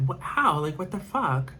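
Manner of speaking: high, sharp burst of disbelief on How; shocked disbelief. Retold or imitated. To her date after he bites her neck, startled disbelief. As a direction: recoil in retold shock.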